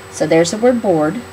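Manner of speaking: ordinary talk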